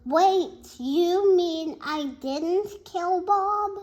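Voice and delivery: loud, high astonished question; surprised. Acted. The narrator stunned to learn Bob lived, high and loud. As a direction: ask it in stunned relief. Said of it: "I asked".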